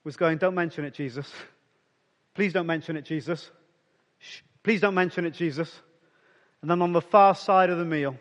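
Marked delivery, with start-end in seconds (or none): 0.4-5.7 softly pleading, hushed embarrassed plea. Retold or imitated. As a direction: beg him quietly to keep it secret. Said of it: "I bet Peter ... was going".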